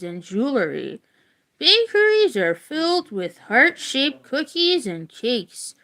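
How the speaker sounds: clear, measured book-reading voice; reading aloud. Acted. Reading a Valentine's history book to viewers, in an even read-aloud voice. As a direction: read it out like a class textbook.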